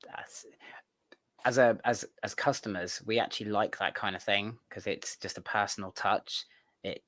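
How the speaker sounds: usual speaking voice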